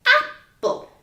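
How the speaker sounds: bright announcing call. Shown as a demonstration. Teacher calls out a picture.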